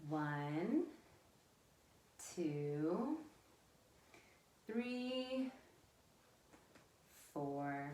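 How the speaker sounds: stretched-out, quiet singsong numbers; counting along. Real, not acted. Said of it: "let's count it back one last time".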